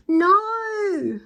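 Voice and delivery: high, loud scream. Acted. Villains scream as goo fills the van, high and loud. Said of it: "the pair screamed".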